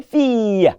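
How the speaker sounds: "fille" drawn out slowly as fee-y; high, slow "fille" ending in a glide; correct French pronunciation. Shown as a demonstration. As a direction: stretch the EE of "fille", leaving out the L. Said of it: "The I L L E there is E".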